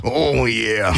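drawn-out wrestler catchphrase; Randy Macho Man. Retold or imitated. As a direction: stretch it out like a pro wrestler. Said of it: "Randy Macho Man".

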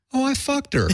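high-pitched gleeful punchline; mock brazen exclamation. Stoned buddy taunts Jack Lemmon about his daughter, mock brazen exclamation. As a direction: blurt it out way up high, imitating a cheeky friend.

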